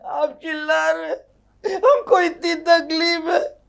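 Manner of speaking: high, tearful whine; mock-wounded wife. Wife complains he shouts at her, tearful whine. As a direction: sound pitifully hurt.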